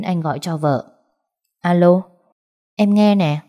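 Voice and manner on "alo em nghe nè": hoarse, strained phone greeting, louder. A narrator's reading. Exhausted wife answers husband's call, hoarse voice. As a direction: push the words out through a husky throat. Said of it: "Giọng cô khàn đặc nghe không ra tiếng".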